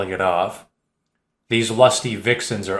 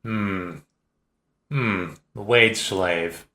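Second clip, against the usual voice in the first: skeptical; 5 dB quieter, much slower than usual. Acted. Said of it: "rubbing his chin skeptically".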